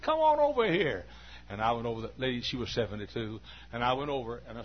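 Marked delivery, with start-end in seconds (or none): 0.0-1.0 put-on high, louder old woman inviting; old lady's invitation. Old lady invites the preacher to swing, high and loud. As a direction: call him over as the old lady.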